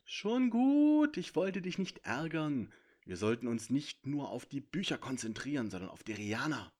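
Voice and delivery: smooth things over with the centaur; placating dragon. A narrator's reading.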